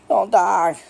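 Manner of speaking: high, loud plea to a pet; mock alarm. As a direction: cry out in playful panic. Calling to his turtle, retold.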